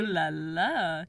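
slow, drawn-out teasing ooh la la. Real, not acted. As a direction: tease her playfully. Teasing about kitchen.

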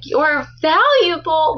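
"valuable" stretched out high and slow; emphatic imitation. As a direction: mimic the audiobook, punching VALUABLE. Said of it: "she puts so much emphasis on that word".

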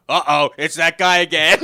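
loud, high mock call-out; outside voice. Comic announcing a returning heckler to the room, outside voice. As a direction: belt it out like you're outdoors. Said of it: "he's using his outside voice".

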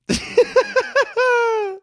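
squealing real laugh; loud high giggle; high burst of laughter. Real, not acted. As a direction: crack up high. Laughing at the story.

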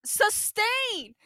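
high, ringing pronouncement; drawn-up theatrical ruling; mock judge's ruling. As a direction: proclaim it like a hammy judge.

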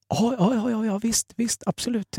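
high, soft, quick nervous yeses; imitating a friend dodging the question; nervous evasive agreement. Friend Peter nervously dodging dinner, high and soft.

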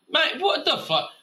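high indignant yelp; what-the-hell disbelief jumping up high; indignant outburst. Real, not acted. Answering his own silly idea, high disbelieving outburst.

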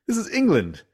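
quoting Richie, pitched high and indignant. Retold or imitated. Retelling a line from Bottom, high mock indignation. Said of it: "Richie's like".

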